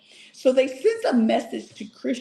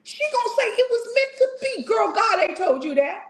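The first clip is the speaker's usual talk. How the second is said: indignant; 14 semitones higher than usual. Real, not acted. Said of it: "indignant rant".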